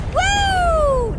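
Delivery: high, loud, drawn-out woo; excited whoop. Real, not acted. Player whooping at the game action, excited whoop. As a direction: let out a long high whoop.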